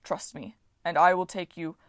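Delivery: lightly voiced gentle giant; Titan voice. A narrator's reading. Voices Bob.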